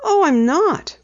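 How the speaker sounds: put-on high, earnest girl voice; earnest insisting. A narrator's reading. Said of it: "Penny maintained".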